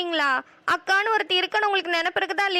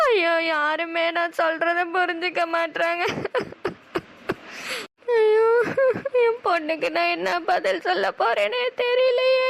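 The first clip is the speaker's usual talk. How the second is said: lament; slower than usual. Acted. Mother laments broken marriage plan.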